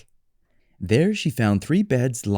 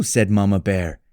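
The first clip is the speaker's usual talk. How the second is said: ordinary delivery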